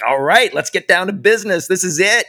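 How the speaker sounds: high, bright let's-go energy; rally yourself to get to work; keen mock battle cry; pumped-up inner voice. Eager quote of own attitude.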